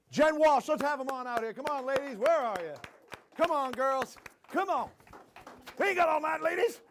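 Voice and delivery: high-pitched call across the room. Real, not acted. Host calling the ladies up on stage, high impatient call. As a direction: summon them with playful impatience, voice lifted.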